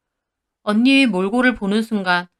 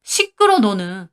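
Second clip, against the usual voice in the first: shouting shut up; slower than usual. Acted. Wife yells at husband to shut up, sharp shout. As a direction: cut him off with a shout. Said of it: "소리쳤습니다".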